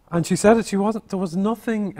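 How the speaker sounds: ordinary talk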